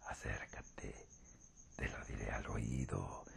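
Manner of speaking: deep, menacing whisper with a sly grin; wolf whisper. Acted. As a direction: lure her close in a low hiss. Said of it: "dijo el lobo con una maligna sonrisa".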